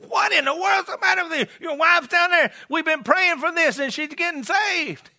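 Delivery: high-pitched, incredulous scolding; imitated exasperation. Church members to husband sitting through wife's conversion, acted disbelief. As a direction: act out baffled church folk confronting him.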